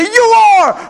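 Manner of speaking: loud, high, emphatic reply; preacher answering a doubter, loud and sure. Real, not acted. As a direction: declare it back at the doubter.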